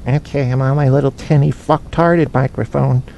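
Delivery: self-mocking little tinny-mic voice; put-on small, tinny voice; tinny microphone voice. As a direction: imitate yourself on a cheap, tinny microphone. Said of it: "And I'm going".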